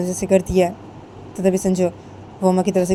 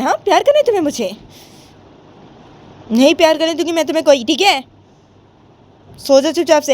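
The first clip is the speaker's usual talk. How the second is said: higher-pitched, exasperated questioning; stern Uma scolding; 7 semitones higher, 4 dB louder than usual. Acted. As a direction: scold someone who is crying, refuse firmly. (ऊँची आवाज़ में चिढ़कर डाँटना)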